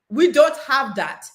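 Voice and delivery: slow Jamaican patois demo; Jamaican accent. Shown as a demonstration. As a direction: show off a Jamaican accent, slowly. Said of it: "Let's see if you can do it better than me".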